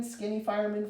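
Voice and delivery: ordinary delivery, nothing marked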